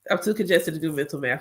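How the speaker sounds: normal talking voice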